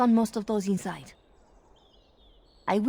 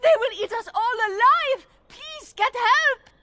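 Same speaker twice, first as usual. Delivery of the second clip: faked terror; 12 semitones higher, 5 dB louder than usual. Erlina begs the guards for help, louder high faked terror. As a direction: overplay the fear until they move. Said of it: "I will go distract the guards".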